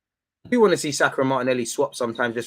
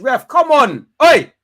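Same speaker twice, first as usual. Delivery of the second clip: much louder and higher furious yell; shouting at ref; 11 semitones higher, 13 dB louder, slower than usual. Real, not acted. Fan screaming at the ref to act, loud yell. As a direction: bellow at the referee.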